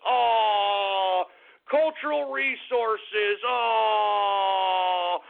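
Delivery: hammed-up fake crying, slow drawn-out moan; mock sobbing. Mocking the humanities endowment's loss with fake crying. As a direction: pretend to weep over the cut, slowly. Mock grief over arts funding cut.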